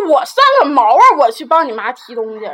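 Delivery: retold indignant snap back, loud on the first words; indignant retort. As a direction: throw back a loud, offended refusal. Retold quarrel with partner.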